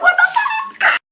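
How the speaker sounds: very high panicked squeal; mock panic. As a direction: squeal it in comic disbelief. Retold panic at being called up.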